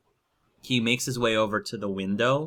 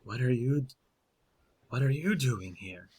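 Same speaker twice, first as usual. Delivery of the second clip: bewildered question; 3 semitones higher than usual. Acted. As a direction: ask it haltingly, a little hushed. Startled werewolf questions the newcomer.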